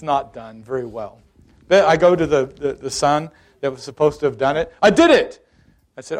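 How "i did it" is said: high, loud insistent outburst; son's protest. Retold or imitated. Son insisting to dad the chore is done, loud protest. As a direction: blurt it out high and loud in self-defence.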